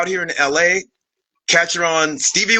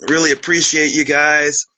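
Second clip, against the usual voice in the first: ordinary talk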